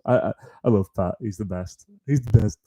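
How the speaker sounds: ordinary talk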